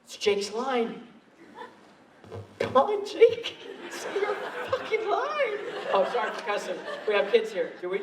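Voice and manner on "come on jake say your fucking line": high, laughing mock pleading; exasperated inner plea. Actor silently begging costar for his line, exasperated. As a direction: beg him under your breath to say it.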